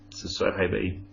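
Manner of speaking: usual speaking voice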